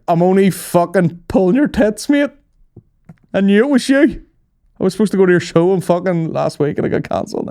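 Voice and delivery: high, loud gushing cop; excited fan. Retold or imitated. Cop revealing to a comedian he's a fan, high excited voice. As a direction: burst out with the prank reveal.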